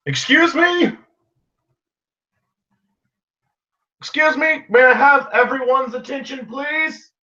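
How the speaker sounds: high, loud call for attention. Acted. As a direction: pitch it high over a noisy crowd. Character calling to noisy crowd.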